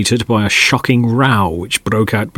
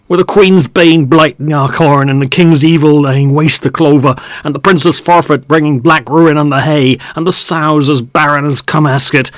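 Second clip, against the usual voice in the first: raised rustic voices arguing; quarrelling farmers; 3 semitones higher, 4 dB louder than usual. Acted. Farm men quarrelling under the window, raised and rustic. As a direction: trade rough country grievances across the yard.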